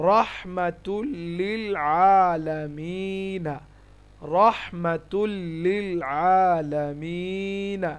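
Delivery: "রহমাতুল লিল" slow, joined on a doubled l; merged recitation. Shown as a demonstration. Teacher reciting the whole phrase, slow merged recitation. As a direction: draw out the long vowels of "আলামিনা" in measured recitation. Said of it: "ইদগাম হয়ে যাচ্ছে".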